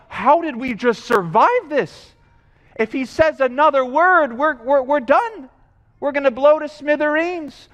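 mock panicked crowd. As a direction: play the rattled survivors. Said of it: "They're afraid of God, and they're like".

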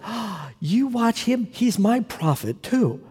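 high, slow, delighted gasp; a nurse thrilled to hear the name; delighted surprise. Retold or imitated. Nurse quoted, squeal of surprise.

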